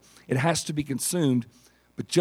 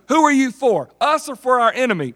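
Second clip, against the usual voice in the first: high, loud demand; challenging Joshua; 9 semitones higher, 4 dB louder than usual. Acted. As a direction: call out the stranger's loyalty.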